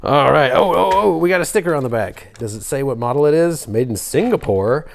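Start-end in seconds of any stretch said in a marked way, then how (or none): none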